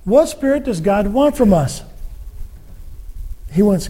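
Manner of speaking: normal talking voice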